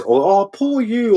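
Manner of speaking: high, whiny mock pity; mock whining. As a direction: mimic them whining about their lot. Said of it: "they'll complain about something because".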